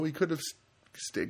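usual speaking voice

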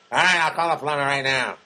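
loud, no-nonsense manager; just bark out that you'll call; Art Burns voice. Retold or imitated. Old manager solving it at once, loud.

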